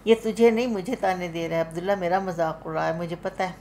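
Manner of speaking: ordinary delivery, nothing marked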